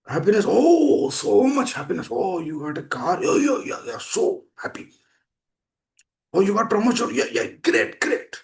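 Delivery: elated happiness. Acted. Preacher acting someone thrilled by a car and promotion, elated. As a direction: burst out overjoyed, piling on the congratulations. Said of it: "you see elated happiness".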